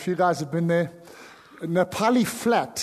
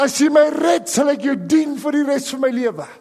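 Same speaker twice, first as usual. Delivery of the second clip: raised, urgent Afrikaans plea; crying out in Afrikaans; 7 semitones higher, 5 dB louder than usual. Retold or imitated. Preacher reliving a drowning boy's vow to God, crying out. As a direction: cry out a desperate vow, high and loud. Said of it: "I cried out, I said".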